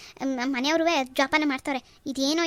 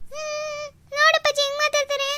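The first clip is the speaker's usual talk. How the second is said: much higher, whimpering sob to her father; tearful complaint; 12 semitones higher than usual. Acted. Daughter sobs to her father about her mother-in-law, high and tearful. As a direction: cry it out like a hurt daughter. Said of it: "ಕಣ್ಣೀರು ಒರ್ಸು".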